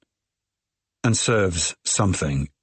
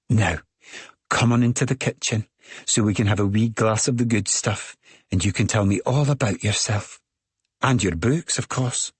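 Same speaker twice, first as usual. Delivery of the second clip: put-on thick Scottish accent; 3 semitones higher than usual. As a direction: gush with a thick Scottish lilt. Midge keeps talking.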